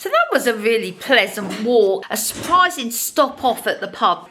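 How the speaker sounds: usual speaking voice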